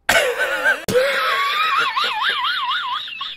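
high shrieking laugh; real giddy laugh. Real, not acted. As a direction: burst out laughing at his joke. After winning joke.

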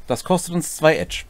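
ordinary talk

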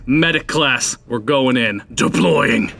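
usual speaking voice

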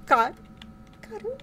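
high, broken-off exclamation; shaken. Real, not acted. Player recovering from a jump scare, shaken cry. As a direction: stammer it high after a fright.

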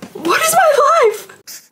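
high, slow squeal of disbelief; stunned disbelief. Real, not acted. Fan to her viewers, squealing in disbelief. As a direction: squeak it out in total shock.

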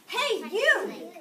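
loud, high, picking a fight; grouchy challenge. A narrator's reading. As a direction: bark it out like a tiny bully. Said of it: "said the grouchy ladybug".

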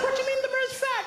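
high, fast incredulous ask; baffled question. Retold or imitated. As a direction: ask it in quick disbelief. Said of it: "I said".

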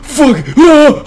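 sudden high yelp of fright; real shriek of fright; startled scream. Real, not acted. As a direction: scream at the jump scare. Jump scare in horror game.

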